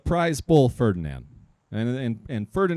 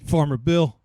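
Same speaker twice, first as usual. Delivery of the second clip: ordinary talk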